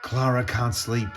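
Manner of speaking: ordinary delivery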